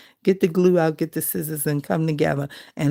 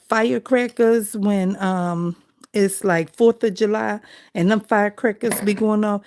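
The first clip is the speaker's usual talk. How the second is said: usual speaking voice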